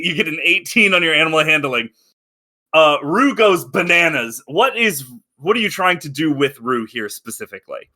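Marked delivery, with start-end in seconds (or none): none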